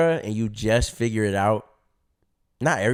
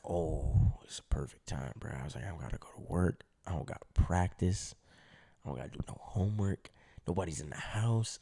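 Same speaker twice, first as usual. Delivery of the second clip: sneaky hush; 7 semitones lower, 11 dB quieter than usual. Retold or imitated. Retold scheming whisper.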